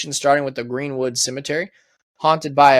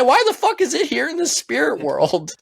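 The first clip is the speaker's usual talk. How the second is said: mock indignant; 11 semitones higher than usual. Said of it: "You're like".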